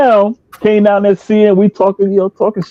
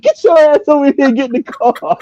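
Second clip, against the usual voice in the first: calling out; 10 semitones higher than usual. Retold or imitated. Calling Brett from the car.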